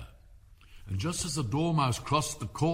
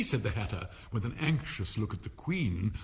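plain reading voice

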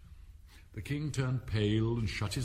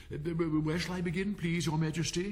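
high, stammering courtier; 8 semitones higher than usual. A narrator's reading. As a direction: stumble over the first word, pitched high. Nervous Rabbit asks where to begin.

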